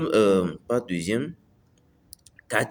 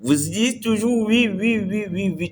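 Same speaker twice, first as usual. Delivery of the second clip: higher eager people-pleaser saying yes; yes-man; 9 semitones higher than usual. Retold or imitated. Vlogger mimicking a people-pleaser, high eager yeses. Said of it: "Vous êtes trop gentil".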